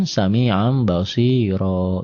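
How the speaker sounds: very slow "sami'an basiro", final "ro" held long; recited with a stop. Shown as a demonstration. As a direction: hum the n of "sami'an" as a long m, stretch the end of "basiro". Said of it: "Maksudnya kalau dihentikan".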